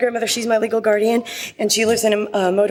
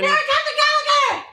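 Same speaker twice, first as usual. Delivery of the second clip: high, fast squealed name; excited shout; much faster than usual. Acted. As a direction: burst in squealing her own name. Character's signature entrance cry.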